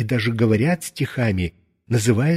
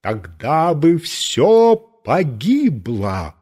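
громовой голос; 2 semitones higher, much slower than usual. A narrator's reading. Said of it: "раздался громовой голос".

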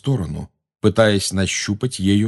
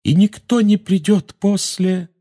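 high, slow prophetic voice; drawn-out, high-pitched apparition; ghostly young woman; 12 semitones higher, 4 dB louder, slower than usual. A narrator's reading. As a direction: intone it like a female spirit. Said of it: "сказала фигура молодой женщины".

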